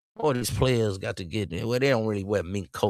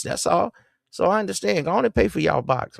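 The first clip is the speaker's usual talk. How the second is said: sarcastic cheer, pitched much higher; mock encouragement; 11 semitones higher than usual. Host mocks players who pay women, with sarcastic high cheer. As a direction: egg them on with fake cheer, voice up high.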